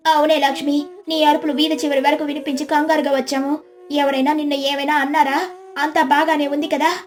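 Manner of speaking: high-pitched, concerned neighbour; anxious young neighbour. Acted. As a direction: ask in worried haste. Voices Gauri.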